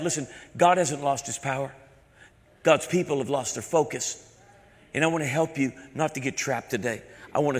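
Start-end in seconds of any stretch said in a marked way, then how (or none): none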